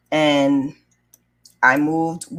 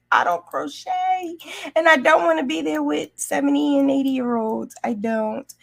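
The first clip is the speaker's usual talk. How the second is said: much higher, whiny reluctant protest; mock protest; 10 semitones higher than usual. Niece refusing to join older ladies' club, whiny mock protest. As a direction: pitch it up like a sulky refusal.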